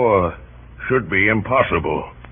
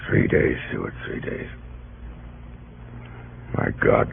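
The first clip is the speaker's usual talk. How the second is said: stunned hush; 10 semitones lower, 8 dB quieter than usual. Acted. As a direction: voice sinking low and quiet, trailing into a whisper. Grim news from the fort.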